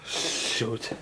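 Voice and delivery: whispered curse under breath. Real, not acted. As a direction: breathe it without voice.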